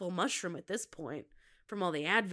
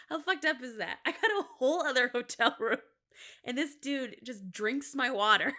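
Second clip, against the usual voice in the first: ordinary talk, nothing marked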